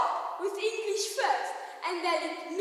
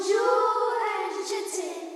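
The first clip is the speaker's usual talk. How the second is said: French greeting; 2 semitones higher than usual. Retold or imitated. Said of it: "When I think of France".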